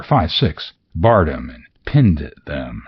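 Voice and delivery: ordinary delivery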